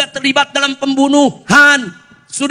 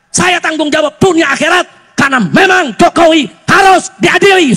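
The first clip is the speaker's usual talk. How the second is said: raised voice rising to a forceful shout; vehement insistence; 4 semitones higher, 5 dB louder than usual. Real, not acted. Preacher taking responsibility before the crowd, vehement shout. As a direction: hammer the final demand home loudly.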